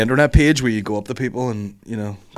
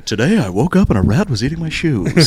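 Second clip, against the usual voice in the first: radio voice; 5 semitones higher, 3 dB louder than usual. Pretend. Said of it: "really good radio voice".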